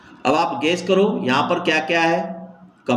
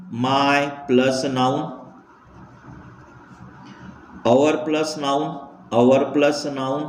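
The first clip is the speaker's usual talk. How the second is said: slow dictation; much slower than usual. Shown as a demonstration. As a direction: spell it out word by word, very slowly. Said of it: "slow dictation to copy down". (बहुत धीरे, रुक-रुक कर लिखवाना)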